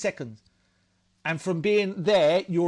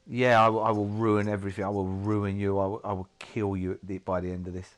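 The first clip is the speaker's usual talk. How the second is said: menacing addiction voice; 6 semitones lower, 4 dB quieter than usual. Retold or imitated. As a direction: speak low as the addiction lurking and waiting. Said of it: "it was like".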